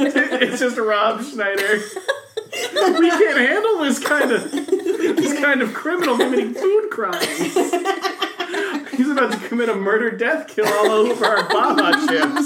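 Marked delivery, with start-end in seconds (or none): 3.0-12.5 high-pitched panicky cop voice; panicked cop. Pretend. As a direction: squeak it out like a cop in over his head. Panicked cops voiced in a riff.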